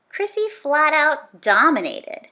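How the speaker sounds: plain reading voice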